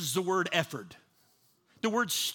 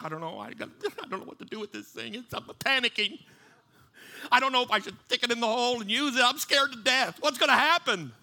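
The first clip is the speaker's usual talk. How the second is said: mock panic; 3 semitones higher, faster than usual. Imagined man at a lock, panicking in mock fear. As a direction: play a man panicking over a simple key, half-laughing. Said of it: "You don't freak out".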